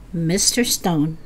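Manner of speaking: plain reading voice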